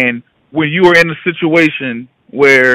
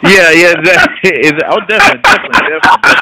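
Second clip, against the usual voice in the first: laughing higher and louder through yeah and definitely; laughing agreement; 3 semitones higher, 6 dB louder than usual. Real, not acted. Laughing agreement after guest's joke.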